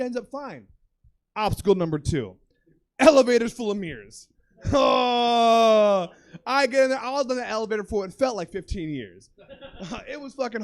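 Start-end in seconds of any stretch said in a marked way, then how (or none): 4.7-6.1 long, loud cry of horror; horrified groan. Retold or imitated. Man facing elevator full of mirrors, horrified wail.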